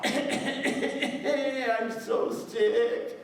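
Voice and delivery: high, very slow hammed-up sick voice; mock sickly rose, drawn out and high; pretend sick. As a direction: fake being sick to get pity. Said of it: "She would pretend to be sick".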